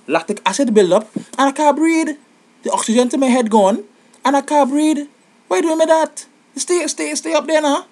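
high-pitched exasperated complaint; whiny high pleading. Pretend. Tall man pleads with short partner not to go down, whining high. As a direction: whine at her to stop bending down.

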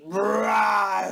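low growly bear; mock bear growl.

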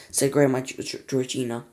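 ordinary delivery

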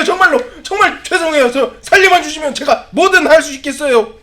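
building to high, frantic begging; acted desperate pleading. Cornered man confessing everything, begging for his life.

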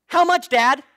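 put-on high, loud little kid asking; squeaky child calling out to dad. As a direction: play the eager small child. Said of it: "little kid with a squeaky voice".

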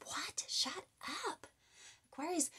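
high, soft, amazed whisper; whispered disbelief. Real, not acted. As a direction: gasp it under your breath. Reacting to the drawn card.

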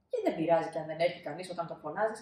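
ordinary delivery, nothing marked

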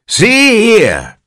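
put-on very high cranky old voice; old man announcing. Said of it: "the old man announced suddenly".

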